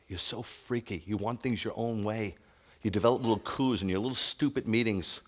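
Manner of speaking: ordinary talk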